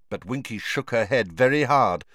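ordinary delivery, nothing marked

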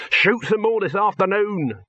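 high, exuberant exclamation; crowing. A narrator's reading. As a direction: crow it out triumphantly. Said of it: "crowed Dibbler".

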